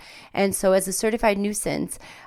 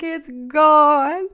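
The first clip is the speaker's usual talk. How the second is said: high, loud wail; high wailing lament; despairing wail; 8 semitones higher, 5 dB louder than usual. Retold or imitated. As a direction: cry out that it's gone. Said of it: "I was like".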